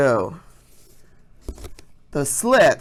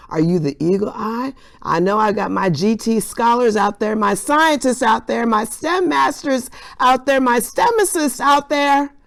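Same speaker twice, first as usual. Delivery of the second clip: building hype; 6 semitones higher than usual. Acted. Teacher hyping up students.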